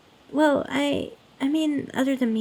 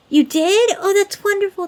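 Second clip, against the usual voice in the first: delighted; 7 semitones higher, 6 dB louder than usual. Acted. Delighted the listener brought food.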